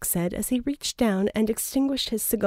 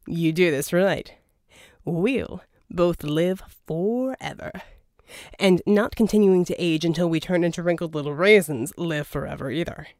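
louder, smooth persuasive schemer; a charming villain's pitch, louder; Verdigris voiced; 2 semitones lower, 3 dB louder than usual. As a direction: sell the deal, oily and grand. Verdigris promising immortality.

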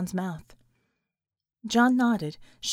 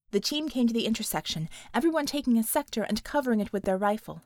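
usual speaking voice

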